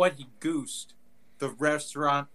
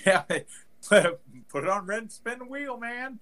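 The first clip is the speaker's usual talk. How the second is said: gushing yes; 6 semitones higher, slower than usual. Retold or imitated. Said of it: "I'd be like".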